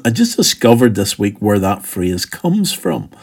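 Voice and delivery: ordinary delivery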